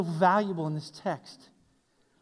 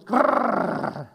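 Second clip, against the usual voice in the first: dog growl. Retold or imitated. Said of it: "the sheepdog just goes".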